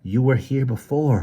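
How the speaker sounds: ordinary delivery, nothing marked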